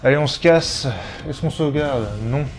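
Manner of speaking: usual speaking voice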